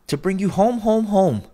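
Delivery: a little sister bursting with joy; little girl calling her brother home, high and gleeful. A narrator's reading. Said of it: "brimful of glee".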